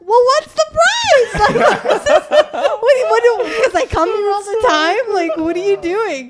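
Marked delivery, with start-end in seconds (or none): 0.1-3.2 excited. Retold or imitated. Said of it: "I'm like".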